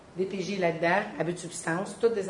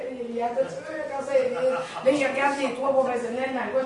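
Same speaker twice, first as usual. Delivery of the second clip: usual speaking voice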